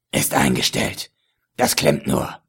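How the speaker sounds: low, leering villain with a chuckle; lecherous villain voice. A narrator's reading. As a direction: play the sleazy dealer, slow and low. Ägypter antwortet. (tiefe, lüsterne Schurkenstimme)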